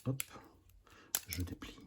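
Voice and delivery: soft ASMR whisper; right in your ear. Real, not acted. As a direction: close to the mic, hushed. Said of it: "moment A S M R".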